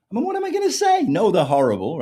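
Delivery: high, loud mock exasperation; exasperated protest. Man asking what he could possibly say, exasperated.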